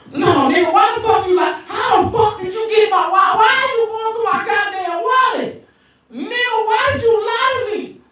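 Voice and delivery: high shouting woman; angry girl. Retold or imitated. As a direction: yell like a furious girlfriend. Angry girlfriend quarrel.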